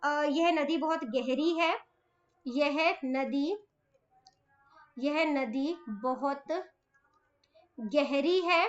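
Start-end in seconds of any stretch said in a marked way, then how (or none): 2.5-8.6 slow, word by word, softer, for writing down; slow dictation. Shown as a demonstration. Teacher dictating a sentence to children, slow and soft. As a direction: spell it out slowly so children can write. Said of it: "छोटे छोटे वाक्य बनाए".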